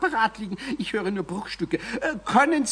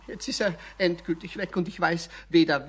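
ordinary talk, nothing marked

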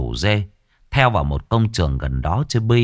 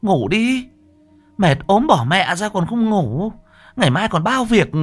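much higher, exasperated nagging; scolding wife; 11 semitones higher than usual. Acted. Wife scolds her sick husband to sleep, high and nagging. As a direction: scold him like an irritated wife.

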